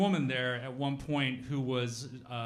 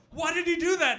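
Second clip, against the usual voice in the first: puzzled football player; 6 dB louder than usual. Retold or imitated. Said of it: "And he's just like".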